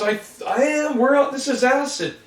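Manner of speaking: put-on a kid freaking out; panicky kid voice. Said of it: "Somebody goes".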